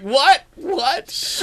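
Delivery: high, rising incredulous what; hammed-up astonished double take; shocked disbelief. Co-host retelling the Shamu discovery, mock shock.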